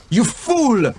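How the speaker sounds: high furious scream. Acted. King screams at his fleeing brother in fury. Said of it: "the king screamed at his brother".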